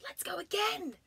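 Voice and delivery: put-on high, bright child bear; eager bear voice. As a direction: beg for another go. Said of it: "said Alan".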